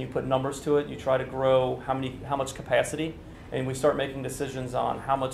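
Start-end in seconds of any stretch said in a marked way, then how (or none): none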